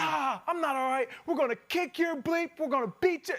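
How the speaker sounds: hammed-up fan trash talk. Heckler threatening player.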